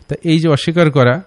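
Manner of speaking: ordinary delivery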